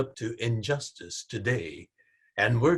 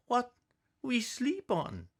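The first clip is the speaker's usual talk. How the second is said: put-on high child's voice, soft at the end; small child; 13 semitones higher, 3 dB quieter than usual. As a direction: ask like a small worried kid. Child asks about beds.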